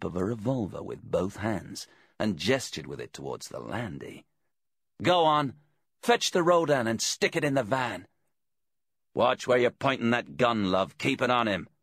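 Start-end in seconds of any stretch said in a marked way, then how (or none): 5.0-11.7 raised voice giving orders; bossy bent detective. A narrator's reading. Detective orders captive to load the van, bossy. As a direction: order him about, higher and firm. Deeks gives orders at gunpoint.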